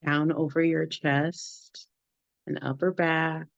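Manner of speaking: unhurried meditation guide with long pauses between phrases; guided meditation. Acted. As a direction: lead the group gently through the body, phrase by phrase.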